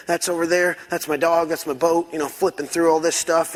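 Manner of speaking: normal talking voice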